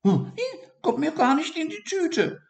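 very high, mocking refusal; high gloating hazel mouse; smirking mouse. A narrator's reading. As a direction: sneer at the idea. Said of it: "feixte die Haselmaus".

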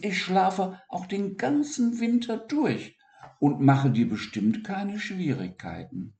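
high, expectant small voice; hopeful voice. Acted. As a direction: ask full of hope. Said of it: "mit erwartungsvoller Stimme".

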